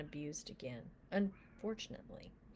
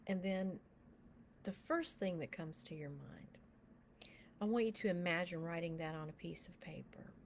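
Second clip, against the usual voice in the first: soft, unhurried prayer guide, often whispered; guided meditation; 6 dB quieter than usual. Acted. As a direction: lead them gently into prayer with eyes closed.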